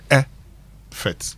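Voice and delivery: "effort" broken up, strong first syllable, weak second; slow teaching demo of "effort"; stress on first syllable. Shown as a demonstration. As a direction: split "effort" in two, leaning on the first part. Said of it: "it means you stress the effort".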